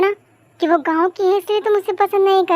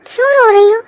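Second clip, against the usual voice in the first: ordinary delivery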